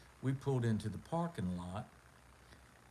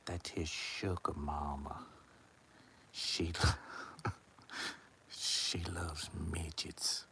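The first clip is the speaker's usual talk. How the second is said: low slow conspiratorial whisper with a laugh; voiceless gossip, slowing down and chuckling; secret whisper; 8 semitones lower, 4 dB quieter, slower than usual. Retold or imitated. Coma gossips secretly about Buck.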